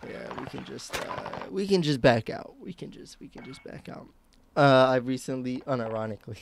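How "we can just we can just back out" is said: breathy whisper, no voice at all. Shown as a demonstration. Streamer repeating his own line to chat, in a whisper. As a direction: drop it to a secret whisper.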